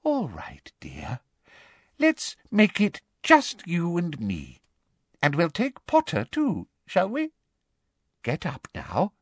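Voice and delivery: syrupy, unhurried coaxing; falsely motherly. A narrator's reading. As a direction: humour her like a child. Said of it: "what she clearly thought was a motherly voice".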